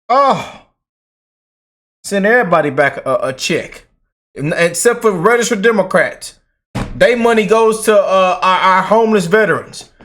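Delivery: normal talking voice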